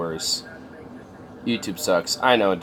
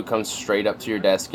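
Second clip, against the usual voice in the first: ordinary talk, nothing marked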